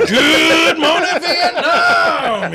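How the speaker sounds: Good Morning Vietnam. Retold or imitated. Friend imitating Robin Williams, slow laughing radio call. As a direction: stretch it like a DJ's wake-up.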